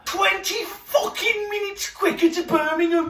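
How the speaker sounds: high-pitched, shouted mock outrage; mock hysterical rant. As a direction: squeal it out in fake hysteria.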